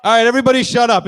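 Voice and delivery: loud, fast bark at the audience; shut up crowd. Real, not acted. Comic silencing the shouting audience, loud and fast. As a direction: cut the hecklers off sharply.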